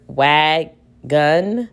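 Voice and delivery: "wagon" split as "wag... gun"; "wagon" syllable by syllable, each held; blending syllables. Shown as a demonstration. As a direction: sound out "wagon" in two slow, separate syllables. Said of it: "if I'm blending this word".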